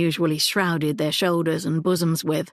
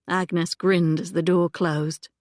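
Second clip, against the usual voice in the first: usual speaking voice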